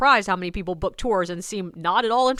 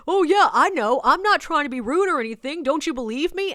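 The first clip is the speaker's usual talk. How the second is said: high, loud protesting at first; insistent stranger; 6 semitones higher, 5 dB louder than usual. Retold or imitated. Stranger insisting to the tour guide, protesting. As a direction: imitate a man who won't stop. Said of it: "he will not stop".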